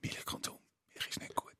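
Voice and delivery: conspiratorial whisper. Acted. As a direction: whisper it like a secret. Secretive anti-milk remark. (geflüstert)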